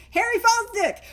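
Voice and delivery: high, forceful announcement of a name; aggressive all caps. Shown as a demonstration. Speaker reading a slide's name, mock-aggressive all caps. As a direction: read it out as if printed in capitals. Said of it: "which I will say is a very aggressive in all caps".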